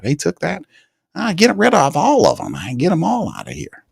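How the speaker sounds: high, hammed-up greedy tyrant king; Mickey as tyrant king. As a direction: play a petty king grabbing land. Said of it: "Mickey would be taking over people's territory".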